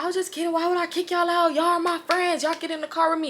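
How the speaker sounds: high-pitched, coaxing imitation; playful friend impression. Friend coaxing everyone back into his car, high and playful. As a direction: mimic a friend brushing it all off. Retold friend claiming he was kidding.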